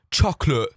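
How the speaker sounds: CHOCOLATE with the final T swallowed; ugly accent imitation. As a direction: punch CHOCOLATE, ending on an ugly vowel, no T. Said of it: "It's the ugliest sound".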